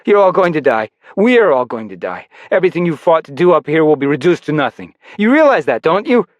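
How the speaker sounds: bitter. Acted. Defeated rebel's bitter prophecy.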